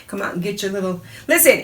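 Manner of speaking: normal talking voice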